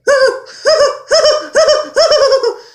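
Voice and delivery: high, lisping cartoon duck cackle; Daffy Duck laugh. Shown as a demonstration. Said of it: "when Daffy laughs, it's like".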